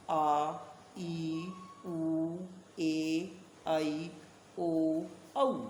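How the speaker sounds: each vowel held long and level, with a pause after it. Shown as a demonstration. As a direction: recite आ, ई, उ as sustained long vowels. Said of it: "दो गुना समय लगता है".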